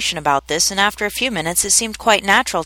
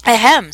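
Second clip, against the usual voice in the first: a pompous, throat-clearing ahem, louder; important ahem; 4 semitones higher, 6 dB louder than usual. A narrator's reading. The mouse calls the wet animals to order with an important ahem. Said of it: "with an important air".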